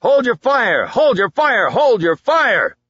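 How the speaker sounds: high, loud repeated command to cease fire; shouting orders. A narrator's reading. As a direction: bellow it over the gunfire. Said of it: "the shooting was quelled by shouting".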